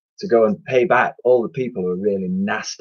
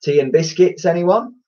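smug offer; 8 semitones higher, 4 dB louder than usual. Acted. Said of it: "remarked Mister Lust".

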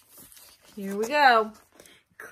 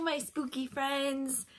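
spooky voice; 8 dB quieter, slower than usual. Pretend. Teacher greets kids spookily.

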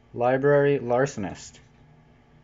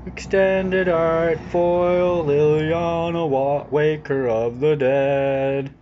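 slow, drawn-out showman reveal, a little higher; grand announcer; 3 semitones higher, 4 dB louder, much slower than usual. Acted. Opening a fancy pack, showing viewers the rare card, slow and grand. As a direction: savour every word like a ring announcer.

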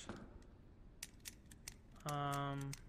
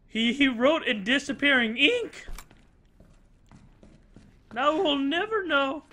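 mock dramatic dismay; 7 semitones higher, 4 dB louder, slower than usual. Mock dismay over the note.

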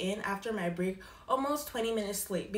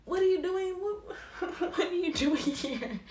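high, laughing disbelief; baffled, high-pitched retort with a laugh; mock incredulous; 8 semitones higher than usual. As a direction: blurt it out high, breaking into a laugh. Startled confronting coworker at back door.

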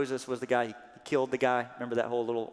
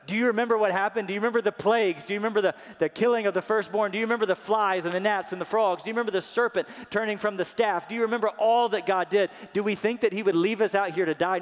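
high, loud, fast challenge; defiant rallying cry; 8 semitones higher, 8 dB louder, faster than usual. Acted. Moses defiantly reminding the Israelites of the plagues, loud and high. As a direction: hammer each do you remember at the crowd. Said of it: "standing defiantly and saying".

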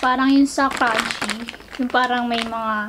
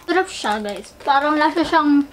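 talking while chewing; 3 semitones higher than usual. Real, not acted. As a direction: food in mouth. Seen 0: Cheeks puffed, lips working after biting snack at -7; cleared by 4.3.

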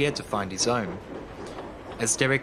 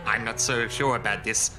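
ordinary delivery, nothing marked

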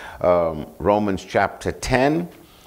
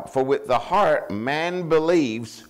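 raised, slow verse reading; scripture quote; 5 semitones higher, slower than usual. Acted. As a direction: lift the verse up slowly for the congregation.

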